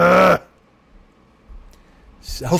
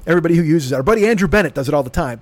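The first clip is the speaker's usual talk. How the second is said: normal talking voice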